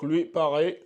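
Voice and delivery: ordinary talk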